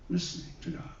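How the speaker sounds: soft whisper, breath only; whispered echo. Real, not acted. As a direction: echo the words under your breath. Echoed in a whisper.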